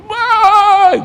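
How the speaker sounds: high, loud, drawn-out whoop; imitated crowd cheer. Humble churchgoers respond amazed, imitated loud cheer. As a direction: let out a piercing mock cheer.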